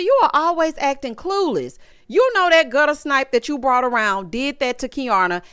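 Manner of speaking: ordinary talk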